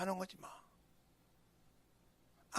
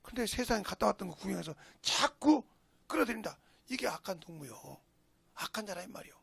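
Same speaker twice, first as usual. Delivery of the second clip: usual speaking voice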